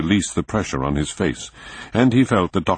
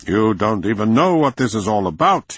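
lifted, dismissive voice; scornful; 6 semitones higher, 4 dB louder than usual. A narrator's reading. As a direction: put him down with scorn. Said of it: "looked at him scornfully".

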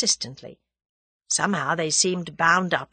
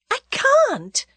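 higher, louder gasped cry; gasped refusal; 6 dB louder than usual. A narrator's reading. Tuppence refusing Julius's proposal, gasped and high. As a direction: blurt it out on a gasp. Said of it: "gasped Tuppence".

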